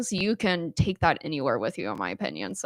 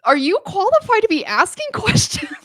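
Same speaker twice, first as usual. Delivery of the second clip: laughing disbelief; 12 semitones higher, 7 dB louder than usual. Retold or imitated. Incredulous retort, laughing through it.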